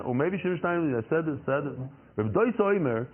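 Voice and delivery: usual speaking voice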